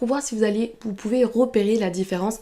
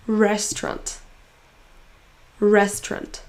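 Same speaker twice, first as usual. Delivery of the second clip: RES in "Restaurant" stressed; stressed syllable drawn out; slower than usual. Shown as a demonstration. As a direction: lean on the first syllable of "Restaurant". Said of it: "en prononçant plus fort la syllabe". (première syllabe de « Restaurant » appuyée)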